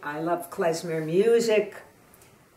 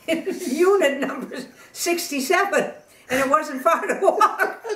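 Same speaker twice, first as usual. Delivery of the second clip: real giggles breaking into the words, higher; laughing through punchline; 8 semitones higher, 4 dB louder than usual. Real, not acted. Old joker laughing at her own joke's punchline.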